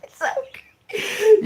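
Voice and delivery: high, drawn-out squeak through laughter; weepy, high laughing voice; helpless laughter. Real, not acted. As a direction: squeeze it out mid laughing fit. Laughing fit, barely getting words out.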